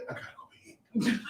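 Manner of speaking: unvoiced whisper. Struggling to pray, whispering. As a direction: breathe it out, strained.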